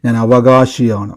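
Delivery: low, slow and drawn out on "അവകാശിയാണ്"; a deep, unhurried vow the crowd can repeat; solemn declaration. Shown as a demonstration. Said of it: "എൻ്റെ കൂടെ ഇന്ന് എല്ലാവരും പറയണം".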